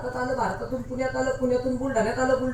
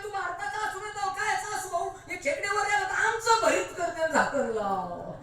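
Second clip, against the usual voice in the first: higher, impatient American officer; impatient official; 7 semitones higher than usual. Retold or imitated. American officer orders the Indian boy to close the jar, impatient and higher. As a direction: hurry him, a lightly voiced foreign officer.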